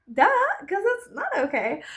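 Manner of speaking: ordinary delivery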